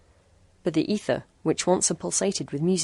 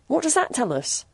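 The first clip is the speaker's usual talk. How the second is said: snapping; 8 semitones higher than usual. A narrator's reading. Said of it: "snapped Jack".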